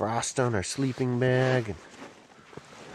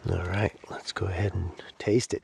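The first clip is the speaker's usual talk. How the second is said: ordinary delivery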